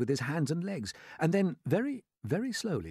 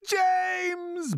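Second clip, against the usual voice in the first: high, loud, stretched-out bawl; 4 dB louder than usual. A narrator's reading. Centipede calls to James for help, bawling. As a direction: bawl the name at the top of the voice. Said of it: "bawled the centipede".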